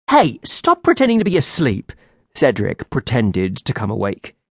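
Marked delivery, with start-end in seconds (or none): 0.1-1.9 scolding. Acted. Scolding a pretending companion.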